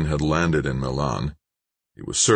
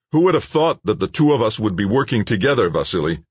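raised, higher call over a roar; shouting over noise; 6 semitones higher, 4 dB louder than usual. A narrator's reading. Dan to Malik over the boat's motor, shouting. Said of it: "shouted over the roar".

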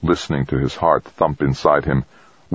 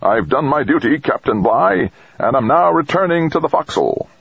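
higher, playful mock-formal report; mock salute bravado; 11 semitones higher than usual. A narrator's reading. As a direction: report in like a cheeky sailor. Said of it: "flipped her a mock salute".

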